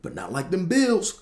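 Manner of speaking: high, loud mocking punchline; taunting yell. Prank caller mocking the Bills loss, loud and high. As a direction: yell the jab up high and slow. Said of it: "they'd be like".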